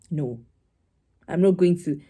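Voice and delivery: usual speaking voice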